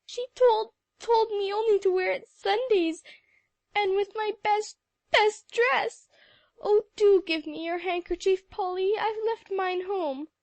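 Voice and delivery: high, loud tearful wail; crying uncontrollably. A narrator's reading. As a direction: wail on, unable to stop. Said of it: "Sally was beyond stopping".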